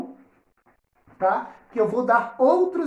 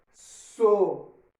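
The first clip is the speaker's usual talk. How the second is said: "Socorro." slowly split as so, cor, ro; syllable by syllable; much slower than usual. Shown as a demonstration. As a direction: break "Socorro." into three syllables, an R on each side of the break. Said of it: "na outra sílaba". ("Socorro." dividido devagar: so, cor, ro)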